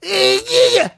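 loud, very high-pitched impression; Andrew Dice Clay. Host showing off an old impression, loud high Dice Clay. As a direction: squeal it out as a comic's catchphrase. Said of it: "I used to do it, Andrew Dice Clay".

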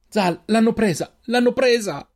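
high, drawn-out anguished cry; tearful shout. A narrator's reading. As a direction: cry out in distress, rising on the repeat. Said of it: "urlò Reynal piangendo". (grido disperato e piangente)

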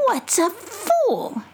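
high, slow suspicious possum. Acted. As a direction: demand it like a wary old possum. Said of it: "in her possum voice".

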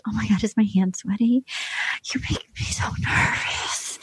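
breathy nervous whisper; whispered Claire quote. Retold or imitated. Host whispers Claire's nervous words on the bed. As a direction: whisper it like a flustered girl on a date.

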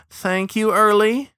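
high, loud, questioning rise; sarcastic prompting. Acted. Fishing sarcastically for thanks.

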